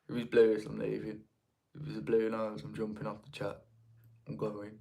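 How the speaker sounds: very soft, low grumble; muttered mock threat. Villa fan faced with Blues fan, muttered mock threat. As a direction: grumble under your breath, pretending to quit.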